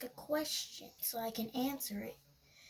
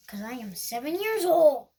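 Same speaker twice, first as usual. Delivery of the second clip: loud proud shout; 12 dB louder than usual. Real, not acted. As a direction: proudly announce it loudly. Loud declaration.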